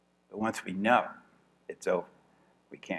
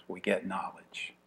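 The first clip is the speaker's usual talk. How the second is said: hushed; 3 semitones lower, 9 dB quieter, slower than usual. Acted. Revealing the turning point.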